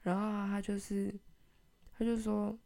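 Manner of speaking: normal talking voice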